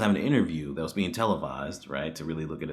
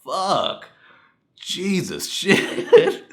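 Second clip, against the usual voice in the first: higher, laughing exasperated curses; exasperated outburst; 9 semitones higher, 8 dB louder, much slower than usual. Retold or imitated. Reviewer reacting to cringey fan scene, laughing exasperation. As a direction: throw up your hands and laugh it off.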